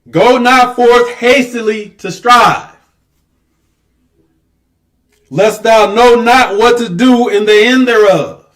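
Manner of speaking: loud, slow, high preaching read; scripture proclamation. Acted. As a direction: proclaim each word slowly. Reading scripture with force.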